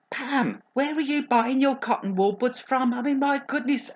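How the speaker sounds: high, exclaiming disbelief; mock-appalled scolding. Agony aunt to Pam about her cotton buds, high mock-appalled scolding. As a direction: scold her in comic alarm, pitched right up.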